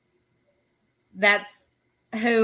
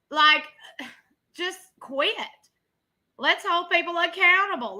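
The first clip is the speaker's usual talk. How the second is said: raised, heated ranting; 5 semitones higher, 6 dB louder than usual. Real, not acted. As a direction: let the frustration boil over. Heated rant about blame.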